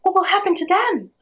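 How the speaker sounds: high, urgent little parrot voice; anxious, high-pitched bird; worried parrot. A narrator's reading. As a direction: plead high for your friends. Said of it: "said the parrot".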